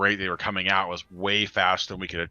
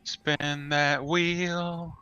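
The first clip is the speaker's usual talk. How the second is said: very slow, higher drawn-out phrase; drawn-out game show call; 8 semitones higher, much slower than usual. Pretend. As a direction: stretch it like a game show host. Mocking the loading spinner.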